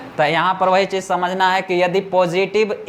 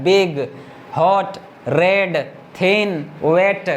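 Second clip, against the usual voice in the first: slow single beats; much slower than usual. Shown as a demonstration. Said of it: "ऐसा थोड़ी है लग रहा है कि दो बार में हम तोड़ के उसको पढ़ रहे हैं".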